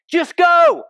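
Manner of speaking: high, loud snapping yell at a slow driver; impatient shout. Retold or imitated. Preacher imitating an angry driver at a stop sign, shouting. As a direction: bark it like road rage. Voicing road rage at a driver.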